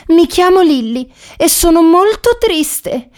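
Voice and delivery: high-pitched, plaintive Lilly voice; sad little dog. A narrator's reading. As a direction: tell your troubles in a high little-dog voice. Sad Lilly explains her plight. (cagnetta triste, voce acuta e lamentosa)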